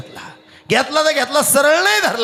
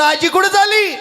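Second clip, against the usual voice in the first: shouted question; 9 semitones higher than usual. Retold or imitated. Man yells at granny where she's going. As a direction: yell the question at her.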